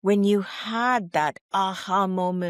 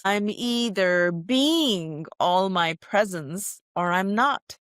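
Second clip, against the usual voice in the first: ordinary talk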